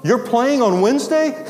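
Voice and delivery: high-pitched disbelieving question; amazed reaction. Retold or imitated. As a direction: echo the news back in astonishment. Teacher reacts to student's news.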